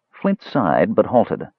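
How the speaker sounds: plain reading voice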